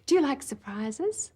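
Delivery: ordinary talk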